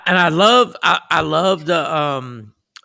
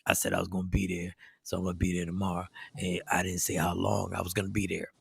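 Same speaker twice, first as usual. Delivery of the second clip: low, soft, fast doped-up mumble; groggy drugged voice; 10 semitones lower, 15 dB quieter, faster than usual. Retold or imitated. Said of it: "I'm doing the voice on what I feel".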